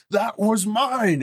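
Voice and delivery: high, slow furious yell; angry shout. Acted. Supplicant shouting at Tyler, high and slow with fury. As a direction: roar it as a claim to what is yours. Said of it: "he now shouts it and goes".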